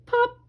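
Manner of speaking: high popping sound; high bubble pop. A narrator's reading. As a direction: make a quick high pop. Bubble bursting.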